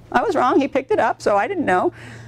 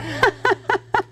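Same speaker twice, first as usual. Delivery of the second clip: delighted laugh; 14 semitones higher than usual. Real, not acted. After the happy story.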